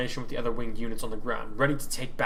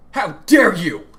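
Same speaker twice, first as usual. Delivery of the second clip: much louder, higher outrage; offended leader roaring at the accusation; 7 semitones higher, 13 dB louder, slower than usual. Acted. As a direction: roar at the insult. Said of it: "Oliver roared".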